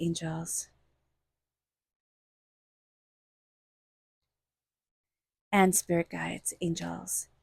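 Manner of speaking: low, soft prayer to spirit guides; murmured invocation. Acted.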